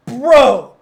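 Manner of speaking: loud, amazed Bro; loud call-out. Retold or imitated. Coworker sees the ripped-out bracket, loud amazed shout. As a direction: bark it in disbelief.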